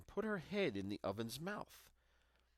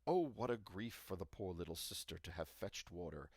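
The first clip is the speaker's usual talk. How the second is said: ordinary delivery, nothing marked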